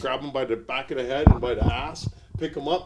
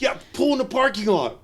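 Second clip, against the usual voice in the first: higher, taunting stranger voice; challenging stranger; 10 semitones higher, 4 dB louder than usual. Retold or imitated. Kansas City fan challenging him, taunting. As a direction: dare him into the lot.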